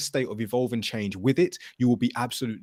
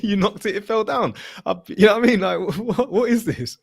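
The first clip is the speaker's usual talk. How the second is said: laughing disbelief; 9 semitones higher, 6 dB louder than usual. Real, not acted. Retelling girlfriend's spilt drink, laughing.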